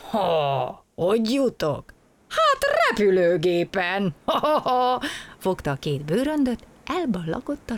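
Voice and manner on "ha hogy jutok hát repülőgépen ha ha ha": louder, gleeful answer capped with laughter; light up and laugh at the new idea; suddenly cheerful. A narrator's reading. Traveller hits on taking a plane, suddenly cheerful. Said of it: "és rögtön vidámabb lett".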